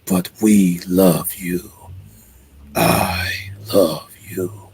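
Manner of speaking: slow, whispered demon voice; a demon whispering a slow, seductive threat; eerie whispered demon, drawn out; creepy demon. Acted. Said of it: "when the demon says it wants a kiss".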